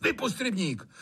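fast, high-pitched angry master; heated, quick outburst at a servant; irritated order. A narrator's reading. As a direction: fire off an impatient command.

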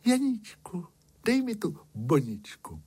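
high-pitched princess, starting high on the name and easing down; storyteller's high princess voice; princess asking. A narrator's reading. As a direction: ask in a high little princess voice. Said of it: "Princezna vyšla ze zámku na most a řekla".

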